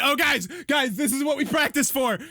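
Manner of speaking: high, loud, pumped-up rallying cry; rally the troops like overeager warriors; hyped-up warrior. Retold or imitated. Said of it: "they're like".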